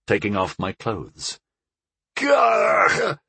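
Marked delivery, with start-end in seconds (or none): 2.1-3.2 sudden high shout of surprise; startled yelp. A narrator's reading. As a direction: jolt awake with a cry. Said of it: "I said in surprise".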